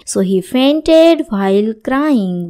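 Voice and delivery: very slow, every word laid out clearly; slow model sentence. Shown as a demonstration. Teacher reading the example sentence to students, very slow. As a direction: say it slowly so learners can follow. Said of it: "teacher models English sentence".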